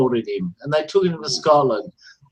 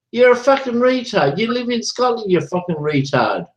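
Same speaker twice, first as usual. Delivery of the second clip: ordinary talk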